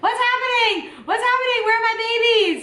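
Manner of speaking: high, loud panicked cries of a frightened mother. Retold or imitated. Mother on the operating table asking for her babies, panicked and high. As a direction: imitate her frantic fear.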